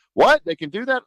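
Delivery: high, startled mock question; shocked candidate. Candidate asks the talk host in surprise, high and startled. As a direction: play the stunned rookie, voice jumping up.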